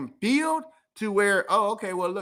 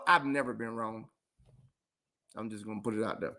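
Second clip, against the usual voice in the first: deep, quiet, deliberate boast; low boast; 12 semitones lower, 7 dB quieter than usual. Real, not acted. To his viewers, a quiet low boast of never being wrong. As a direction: drop low and quiet for the brag.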